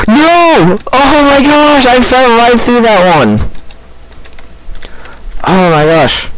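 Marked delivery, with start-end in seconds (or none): 0.1-3.4 high-pitched cry of dismay; dismayed outcry. Real, not acted. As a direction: wail it as the jump goes wrong. Falls through in the game.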